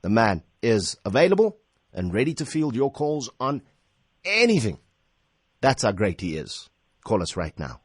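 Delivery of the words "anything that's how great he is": ordinary delivery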